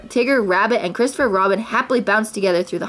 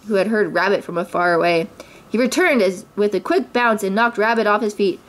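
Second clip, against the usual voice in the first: ordinary delivery, nothing marked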